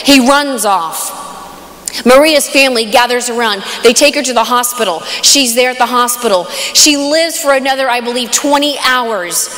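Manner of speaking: ordinary delivery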